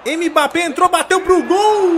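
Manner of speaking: high, loud, slowed shot shout; shouted commentary. Real, not acted. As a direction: shout the danger. Opponent shoots.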